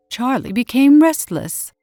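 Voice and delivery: usual speaking voice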